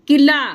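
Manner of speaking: Qila said with the ला open, clear and full. Shown as a demonstration. Teacher showing the open aa on ला. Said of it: "ला को अलग से बोल रहे हैं किला".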